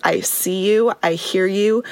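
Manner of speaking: usual speaking voice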